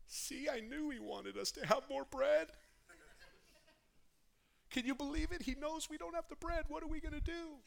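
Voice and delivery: a touch of flustered panic among friends; fretting disciples. Acted. Disciples panicking over the bread.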